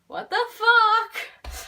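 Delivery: slow, drawn-out high loud what the fuck; stunned disbelief. Real, not acted. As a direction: let the disbelief explode, stretching every word.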